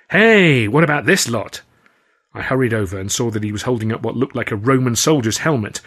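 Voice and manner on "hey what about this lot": bright, high and loud call of delight; gleeful shout. A narrator's reading. Stan calling out a discovery in the warehouse, gleeful shout. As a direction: shout out a happy find. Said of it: "he let out a gleeful shout".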